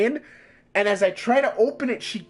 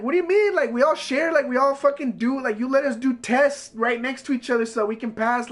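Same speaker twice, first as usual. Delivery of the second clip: retold indignant objection, higher pitched; indignant protest; 4 semitones higher than usual. Student protesting to the teacher about sharing a book, indignant. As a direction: argue back in mock disbelief, voice raised in pitch.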